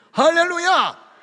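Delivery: high, loud jubilant shout; ecstatic hallelujah. Acted. Preacher shouting hallelujah at God's answer. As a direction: burst out in joyful praise.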